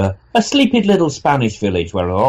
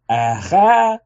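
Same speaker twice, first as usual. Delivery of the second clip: put-on Yiddish accent, higher pitch; 8 semitones higher than usual.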